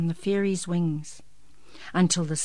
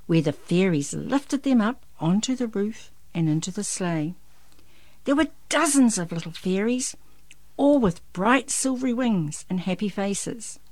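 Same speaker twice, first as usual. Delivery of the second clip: ordinary delivery, nothing marked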